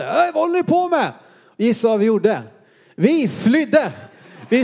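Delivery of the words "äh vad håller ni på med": high, retold call from a distance; adult calling out. Retold grown-up calling out at boys by the bonfire. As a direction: call out at the kids in mock alarm.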